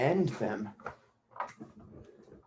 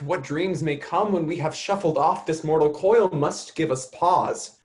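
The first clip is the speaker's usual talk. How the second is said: normal talking voice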